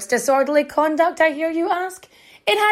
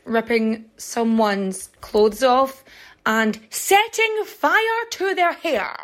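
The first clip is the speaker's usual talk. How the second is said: ordinary delivery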